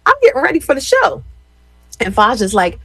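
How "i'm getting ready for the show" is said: breezy reply. Retold or imitated. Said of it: "I'm like".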